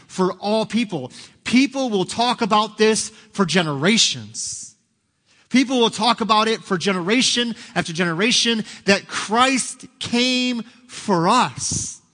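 high, loud, slowing at the end; preaching at full force. Acted. Preacher to congregation, soaring proclamation. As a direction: proclaim it to the back row, drawing out the last words.

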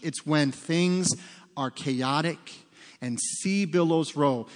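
ordinary talk, nothing marked

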